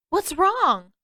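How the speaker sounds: high, loud call from the water; shouting over the waves; shouted question. A narrator's reading. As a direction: shout it across the distance. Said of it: "Aang shouted".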